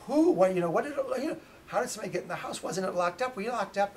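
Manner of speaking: high, loud burst of startled questions; alarmed questioning. Retold or imitated. Daughter hears somebody got into the house, alarmed and loud. Daughter reacts to news of intruder.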